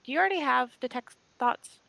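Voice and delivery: usual speaking voice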